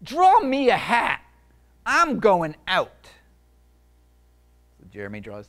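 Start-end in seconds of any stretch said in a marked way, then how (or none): 0.0-3.1 loud, high, slow final orders; bossy monster. Acted. Monster impatient with Jeremy, loud and bossy. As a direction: demand it impatiently. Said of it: "the monster said".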